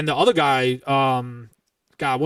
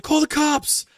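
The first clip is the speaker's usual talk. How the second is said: frantic bystander; 12 semitones higher, slower than usual. Retold or imitated. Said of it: "someone's like".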